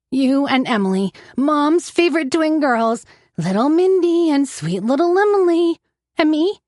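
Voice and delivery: mock the cute twins bitterly; bitter mockery. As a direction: narrator mimics Mom's doting on twins, sneering high. Bitter mocking of mom's favorites.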